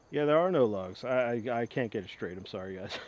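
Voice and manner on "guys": usual speaking voice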